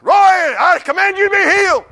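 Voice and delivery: hammed-up healer shouting the command; mock healing command.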